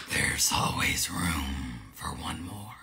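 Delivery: deep, slow, eerie ghostly whisper; scary voice. Pretend. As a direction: whisper it low and slow like a haunting. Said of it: "because I have another voice".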